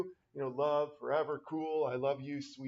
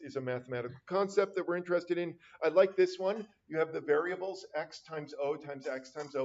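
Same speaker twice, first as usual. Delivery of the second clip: normal talking voice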